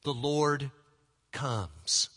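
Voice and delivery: ordinary talk, nothing marked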